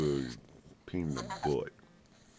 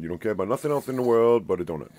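teasing scold; 5 semitones higher, 9 dB louder, faster than usual. Real, not acted. Scolding the greedy pet.

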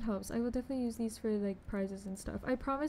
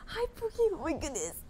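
excited squeal; 6 semitones higher than usual. Real, not acted. Excited greeting to a friend.

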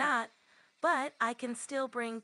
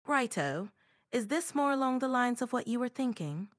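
stronger British accent; more British accent; 3 semitones higher than usual. Shown as a demonstration. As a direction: lean further into a British accent. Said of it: "More British".

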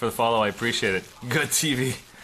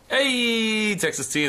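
high, drawn-out Hey; delighted hail; 12 semitones higher, slower than usual. Real, not acted. Streamer hailing chat over Texas tea, high delighted hail.

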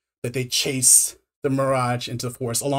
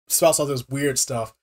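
ordinary talk, nothing marked

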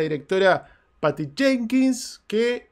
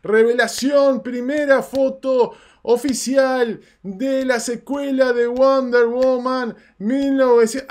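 grand reveal; 6 semitones higher, 6 dB louder than usual. Acted. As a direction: announce it like big breaking news. Mock grand reveal announcement. (anuncio exagerado, fuerte y lento)

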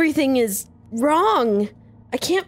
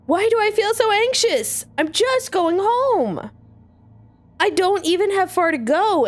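anxious; 6 semitones higher than usual. Acted. Heroine anxious inner thoughts.

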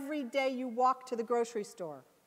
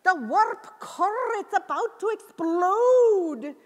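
slow, much louder and higher, in a bad Scottish accent; terrible Scottish accent; 8 semitones higher, 12 dB louder, much slower than usual. Scotty warning the bridge of the warp core in a loud hammed-up Scottish accent. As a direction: ham up a panicked Scottish engineer. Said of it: "I do a terrible Scottish accent".

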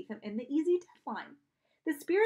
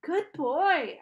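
weak attempt at praising a dog, pitched up; weakly; 6 semitones higher, 8 dB louder than usual. A narrator's reading. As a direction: say it weakly though still out loud. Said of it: "I said weakly".

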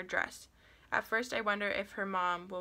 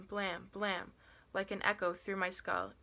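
usual speaking voice

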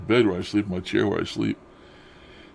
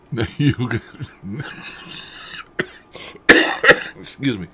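usual speaking voice

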